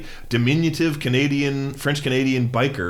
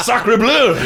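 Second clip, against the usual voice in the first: high, loud mock French exclamation; exaggerated French accent; 11 semitones higher, 9 dB louder than usual. Imagined Trudeau tumbling downstairs, exaggerated French-accented cry. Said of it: "He doesn't have a French accent".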